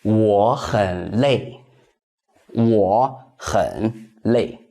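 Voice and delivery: slow, careful Mandarin for learners; slow teacher's demo; slow model sentence. Shown as a demonstration. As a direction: say it slowly, syllable by syllable, for students to copy. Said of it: "That would be".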